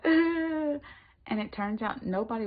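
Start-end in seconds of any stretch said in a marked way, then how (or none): 0.0-0.8 retold groan. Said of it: "And I was like".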